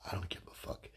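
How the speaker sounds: whispered, a private aside. Real, not acted. Comedian aside to the crowd, whispered. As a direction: breathe it out as an aside. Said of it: "aside to audience".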